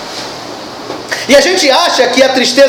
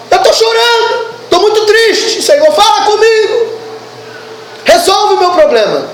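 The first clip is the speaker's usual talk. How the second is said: higher and crying out, hammed-up despair; mock weeping plea; 7 semitones higher than usual. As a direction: parody someone wailing to God for help. Mimics a weeping believer crying out.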